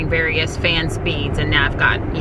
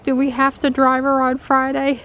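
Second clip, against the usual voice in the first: high mock whiny voice, much higher; imitating Don; 10 semitones higher than usual. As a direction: squeak it out like a fussy husband. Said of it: "he's like".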